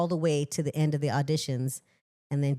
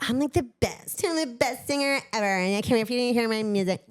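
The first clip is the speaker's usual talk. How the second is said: higher, fast, hammed-up boasting; mock bragging; 6 semitones higher, faster than usual. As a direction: play the smug braggart. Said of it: "that feels like crap".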